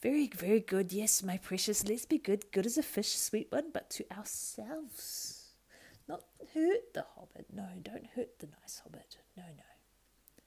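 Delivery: high whining creature voice shifting to hissed whispers; Gollum voice. Acted. Gollum arguing with himself, wheedling then whispering. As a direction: wheedle and hiss like a split creature.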